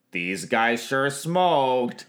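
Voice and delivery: drawn out slowly, deadpan; slow drawl. Retold or imitated. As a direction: let each word hang. Quoting Gavin's deadpan line.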